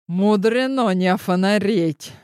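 usual speaking voice

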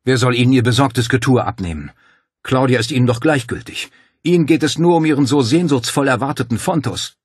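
loud, high, accusing; furious rebuke. A narrator's reading. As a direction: tear into the doctor angrily. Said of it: "machte Paul wütend".

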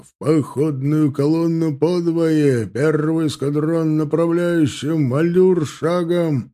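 lightly voiced booming colonel. A narrator's reading. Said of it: "зычный голос полкового командира".

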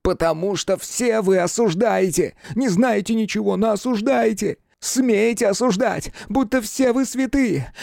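high-pitched, impassioned torrent; fervent outburst. A narrator's reading. Lovestruck mathematician defending his beloved, fervent and overwrought. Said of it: "он заговорил пылко и быстро, аж задыхаясь".

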